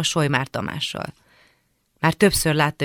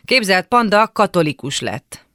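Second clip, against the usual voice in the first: loud, raised call; 4 semitones higher, 7 dB louder than usual. A narrator's reading. Sibling calling news into the bathroom, loud and high. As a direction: shout the news through a door. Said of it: "és bekiabáltam".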